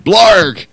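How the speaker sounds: loud goofy blarg cry; silly yelled blarg. Pretend. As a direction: blurt a cartoon noise. Silly outburst noise.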